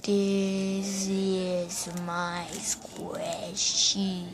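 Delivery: very slow, long pauses; talking very slow. Acted. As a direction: drag every word out, very slowly. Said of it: "the talking very, very slow person".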